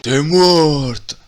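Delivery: drawn-out, loud menacing shout; slow loud threat. Acted. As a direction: spit the threat slowly. Villain threat.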